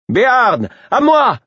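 high, loud rallying shout; rally the troops with authority; battle cry of a commander; commanding shout. A narrator's reading. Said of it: "cria-t-elle avec une évidente autorité".

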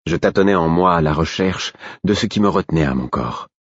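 ordinary delivery, nothing marked

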